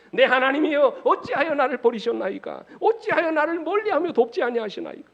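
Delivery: loud, high cry to God; anguished plea. Acted. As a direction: declaim the lament at full force, pitched high. Preacher declaims a psalm's lament.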